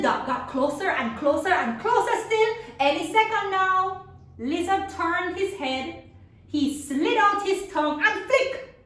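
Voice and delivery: mounting excitement; rising suspense. A narrator's reading. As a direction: build the hunt to the strike. Building tension of hunt.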